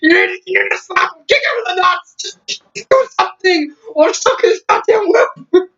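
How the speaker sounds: high-pitched mock yelling, cursing nonstop; cursing shout. Wife cursing her husband out, high mock yelling. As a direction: scream curses at him in a shrill falsetto. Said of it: "just cursing him out nonstop".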